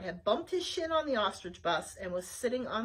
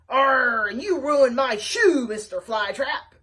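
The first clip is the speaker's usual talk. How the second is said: loud, slow pirate boom; 11 dB louder, slower than usual. Acted. Giant pirate roars at Flytrap, loud and slow. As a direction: bellow like a peg-leg pirate. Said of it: "a big booming voice".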